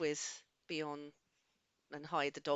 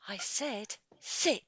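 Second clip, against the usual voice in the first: stern command; 5 semitones higher, 6 dB louder, slower than usual. Acted. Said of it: "I'm just gonna say".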